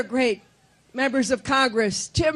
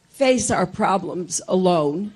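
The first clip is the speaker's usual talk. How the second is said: usual speaking voice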